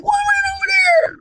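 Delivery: high, panicked shriek; shrieking friend. Retold or imitated. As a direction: shriek it in a put-on girly voice.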